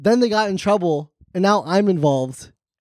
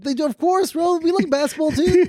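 high, playful mock-indignant voice; lightly voiced defensive fan; playful Middle Eastern voice; 9 semitones higher than usual.

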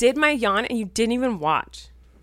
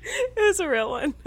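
laughing admission; 10 semitones higher than usual. Real, not acted. Admitting laugh was real.

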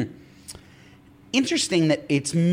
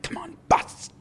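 whisper, then squeal for a bust; pleading whisper rising to cry; 11 semitones higher, slower than usual. Retold or imitated. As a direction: beg the dealer, building up. Pleading at casino table.

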